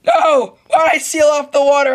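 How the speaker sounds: loud panicked cries; panicked yelling. Real, not acted. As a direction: yell in a panic as you drown. Drowning in game, panicking.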